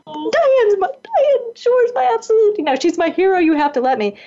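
high, excited begging; re-enacted breathless-fan insistence; urgent pleading. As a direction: plead your case with voice climbing way up. Begging organisers for one more turn.

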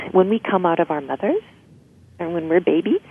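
ordinary delivery

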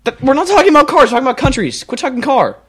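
high, loud frustrated protest; exasperated outburst. Real, not acted. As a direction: blurt it out, fed up. Exasperated host scolds guest.

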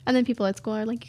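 normal talking voice

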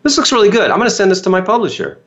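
quick, lifted, breezy praise from a mentor; Jack's approval. Retold or imitated. Jack Canfield praising the young author's book, quick and lifted. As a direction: rattle it off like a generous author who is impressed. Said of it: "He goes".

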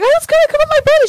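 very high, loud, rushed squeal; screaming excitedly. Retold or imitated. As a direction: re-enact a breathless excited shriek. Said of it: "screaming and running across".

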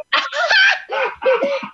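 high, shrieking laugh that breaks out. Real, not acted. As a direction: let a big laugh burst out. Host bursts out laughing on air.

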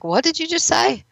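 imitating someone caught off guard by a jab; taken-aback question. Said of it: "when you look up at me like".

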